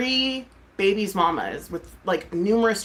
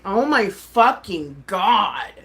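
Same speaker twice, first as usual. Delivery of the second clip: retold exasperation; 4 dB louder, much slower than usual. Retelling exasperation at an accusation.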